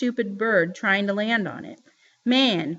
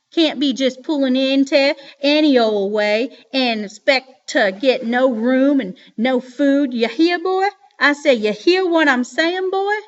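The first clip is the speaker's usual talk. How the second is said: Dad mocking a Southern hillbilly accent, louder and higher; 3 semitones higher, 5 dB louder than usual. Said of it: "Dad did an imitation of a hillbilly accent".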